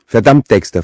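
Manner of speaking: furious outburst, acted by narrator; angry cursing. A narrator's reading. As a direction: swear at your brother in exasperation. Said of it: "fluchte sie".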